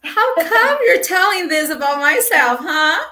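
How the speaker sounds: mock offended.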